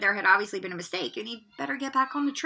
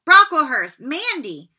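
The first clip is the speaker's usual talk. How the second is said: calling out names; 5 semitones higher, 11 dB louder, slower than usual. Acted.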